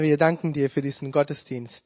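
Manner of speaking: normal talking voice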